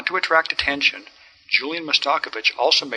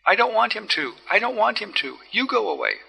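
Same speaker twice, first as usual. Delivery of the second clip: put-on higher, pleading cry of a frightened girl; 9 semitones higher than usual. A narrator's reading. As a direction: plead high, near tears. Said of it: "cried the girl".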